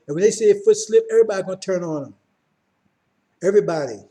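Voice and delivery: loud, driving preacher building to a peak; preaching at full force. Acted. Preacher warning brothers and sisters of war, preaching hard. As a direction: hammer the warning home to the congregation.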